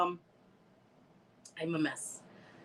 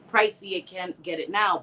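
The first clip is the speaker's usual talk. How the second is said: usual speaking voice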